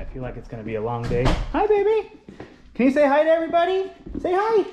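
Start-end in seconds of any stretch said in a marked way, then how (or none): 1.5-4.6 very high, louder squeaky coo; pet-talk voice. Real, not acted. Owner showing the dog to viewers, high pet-talk voice.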